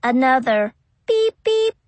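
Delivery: rising beep sound; imitated beep. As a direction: beep like a keypad. Keypad beeps again.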